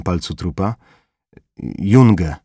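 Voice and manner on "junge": ordinary delivery, nothing marked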